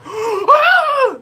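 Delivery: high, loud hammed-up gasp and cry; mock shocked gasp. Mocking offended viewers, loud shocked gasp. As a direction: gasp like a scandalised prude.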